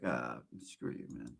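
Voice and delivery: very low, quiet retold dismissal; slow, soft, offhand brush-off under the breath; muttered brush-off. Host waving off close friends who ask about stuff, muttered dismissal.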